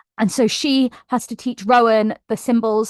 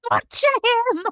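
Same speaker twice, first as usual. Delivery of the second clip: high, delighted cheer; bloodthirsty reader cheering; gleeful cheering; 13 semitones higher, slower than usual. Retold or imitated. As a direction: egg on the torture like a gleeful fan. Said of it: "you're going".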